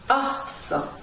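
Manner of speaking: "Awesome" with the A high, then falling; upstress on first syllable. Shown as a demonstration. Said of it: "would go on the A".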